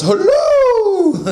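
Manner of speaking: put-on high, loud sing-song old nanny greeting; Mrs. Doubtfire. The genie greets him as a nanny, high and loud. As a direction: chirp it like a cheery old nanny. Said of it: "It's Missus Doubtfire".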